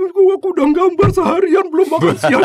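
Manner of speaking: high, loud, tearful complaint; junior voice. Retold or imitated. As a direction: whine it high like a crying junior. (meniru junior menangis dengan suara tinggi dan keras)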